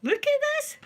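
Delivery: high, loud squeal; very high-pitched, delighted show-and-tell; excited reveal. Real, not acted. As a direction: squeal it as you show off a treasure. Showing off a purchase to viewers.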